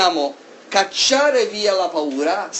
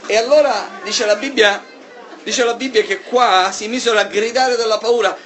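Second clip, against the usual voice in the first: loud, raised preaching voice; fiery preaching; 5 semitones higher, 4 dB louder than usual. Acted. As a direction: preach it out to the church. Preaching at full force.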